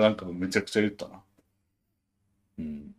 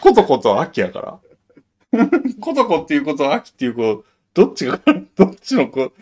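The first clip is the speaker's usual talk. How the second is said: laughing; 5 semitones higher, 6 dB louder than usual. Real, not acted. Laughing while retelling.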